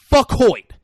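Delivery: loud high curse; shouted. Retold or imitated. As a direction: spit it out loud. Acted quote.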